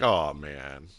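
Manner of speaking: low, deflated groan of regret; mock regret. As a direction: play let down, dropped right down in pitch. Acted regret after giving in.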